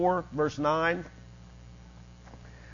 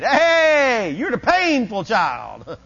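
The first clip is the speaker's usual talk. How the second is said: high, loud, drawn-out tease; mock taunting call; 4 semitones higher, 5 dB louder, much slower than usual. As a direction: holler it like kids teasing another child. Voices people teasing young Jabez.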